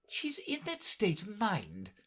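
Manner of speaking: high, fretful voice. A narrator's reading. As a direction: fuss about the girl.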